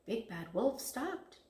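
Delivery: ordinary delivery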